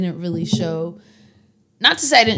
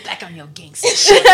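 laughing tease; 14 semitones higher, 7 dB louder than usual. Real, not acted. Teasing friend, laughing hard.

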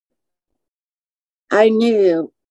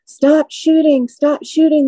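higher, slower mock yell of warning; a hint of a neighbor shouting across the yard; retold yelling; 8 semitones higher, slower than usual. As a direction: echo the neighbor's frantic call, lightly. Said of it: "I heard my neighbor yelling".

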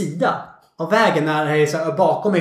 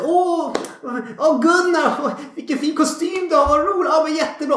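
much higher, gushing mock flattery; fake flattery; 9 semitones higher than usual. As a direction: gush sweetly over his suit. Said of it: "alla känner till det här". (mycket ljus, överdrivet inställsam röst)

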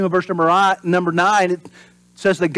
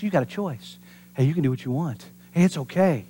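soft, sly coaxing voice, softer; tempting whisper; 3 semitones lower, 7 dB quieter than usual. Retold or imitated. Preacher voicing Satan tempting the congregation, soft and sly. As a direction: coax gently like a tempter. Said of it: "Satan just says".

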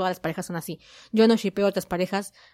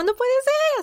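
imitating a very high excited shriek; hammed-up squeal of disbelief; excited scream; 3 dB louder, slower than usual. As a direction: shriek it in overwhelmed joy. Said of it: "Grita de la emoción".

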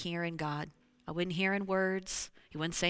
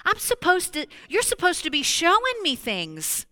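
loud pleading complaint; 10 semitones higher, 7 dB louder than usual. Retold or imitated. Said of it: "And I said".

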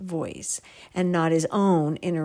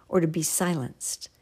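ordinary talk

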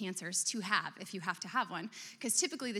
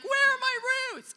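much higher, slow panicked whine; mock panic; 7 dB louder, slower than usual. Panicked believer in a storm, high mock whine. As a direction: squeal it like a frantic believer.

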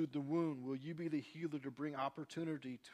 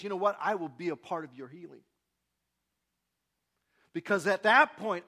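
loud, high, fervent preaching; full-force preaching; 7 semitones higher, 13 dB louder than usual. Acted. Pastor preaching loud to the congregation about healing. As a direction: preach it out to the whole room.

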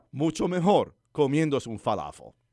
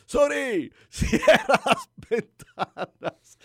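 much higher, louder and slow, through laughter; 12 semitones higher, 8 dB louder, much slower than usual. Shown as a demonstration. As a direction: call the command out loud and drawn out, laughing. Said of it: "tengo que decirlo más alto". (grito lento y agudo a la computadora, riendo)

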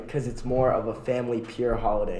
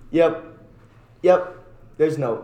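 usual speaking voice

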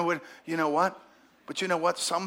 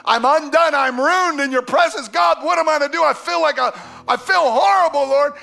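loud, high cry of being undone; anguished cry; 9 semitones higher, 8 dB louder than usual. Retold or imitated. Isaiah undone before the Lord, crying out loud and high. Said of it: "that goes".

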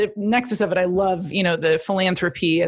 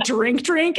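usual speaking voice

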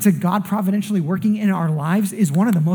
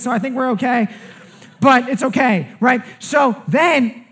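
higher, animated retelling of the chase; excited storytelling; 7 semitones higher than usual. Retold or imitated. Pastor telling the congregation the chase story, higher and animated. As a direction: pastor building suspense as he tells the story.

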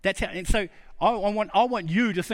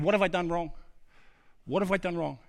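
soft, slightly lowered asking voice; person seeking answers; 3 semitones lower, 4 dB quieter than usual. Acted. Person asking someone about a broken relationship, quietly questioning. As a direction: ask it quietly, a touch humbly. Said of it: "you've gone to somebody and said".

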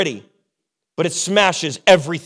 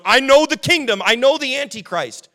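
higher, louder mocking boast; hammed-up know-it-all believer; 7 semitones higher, 4 dB louder than usual. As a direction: lampoon a self-sure end-times expert. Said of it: "running going".

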